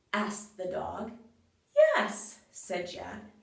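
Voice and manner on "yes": bright high-pitched yes; Jack's high yes. Acted. Jack cheerfully agreeing to the cat, high pitched. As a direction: pop the yes up high and light.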